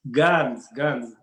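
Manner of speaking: slow, heavy mock Russian; hammed-up Russian accent. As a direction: lay on a thick Russian accent, stretching the words. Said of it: "Russian accent".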